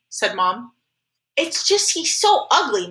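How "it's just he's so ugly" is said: put-on high, slow little-kid whine; pleading little brother. Little Jamie justifies himself to mommy, pleading. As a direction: plead like a small boy.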